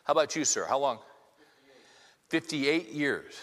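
ordinary delivery

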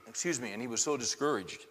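usual speaking voice

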